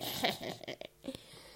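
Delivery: breathy sinister laugh; menacing hushed chuckle; creepy whispered laugh. Pretend. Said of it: "after you heard that voice".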